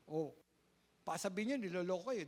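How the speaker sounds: normal talking voice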